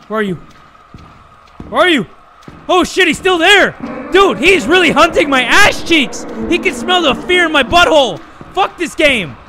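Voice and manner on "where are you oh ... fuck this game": loud, high-pitched alarm; frantic gamer yelling; panicked shouting. Real, not acted. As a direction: yell in real panic as the monster hunts you. Panicking at monster hunting him.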